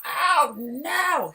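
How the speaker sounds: high, loud horrified "Oh, no!"; imitating a friend's horrified scream; retold horrified yell. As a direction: shriek it like you can't bear to watch. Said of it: "He was sitting there going".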